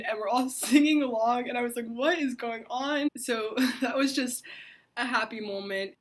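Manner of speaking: giggling with delight at the memory; laughing. Real, not acted. Laughing through happy memory.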